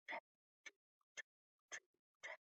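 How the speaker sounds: almost silent whisper; very faint trip trap. A narrator's reading. Teacher whispering faint hoofsteps, almost silent. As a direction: barely breathe the hoofsteps. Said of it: "hooves very faint".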